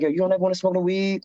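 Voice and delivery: a lightly voiced curious fellow inmate; curious inmate friend. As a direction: voice pitched well up, asking eagerly. Friend voiced in a prison story.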